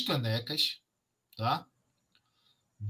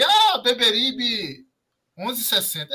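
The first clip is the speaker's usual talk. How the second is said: arrastado e mais agudo, debochando; imitando com deboche; 6 semitones higher, 4 dB louder, slower than usual. Retold or imitated. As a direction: zomba do endereço errado, lento. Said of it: "tão postando aí". (voz de deboche)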